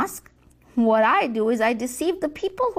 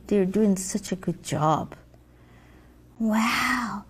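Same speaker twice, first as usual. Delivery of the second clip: ordinary talk, nothing marked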